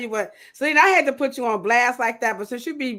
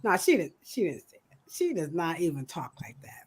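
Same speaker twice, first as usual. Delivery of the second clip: normal talking voice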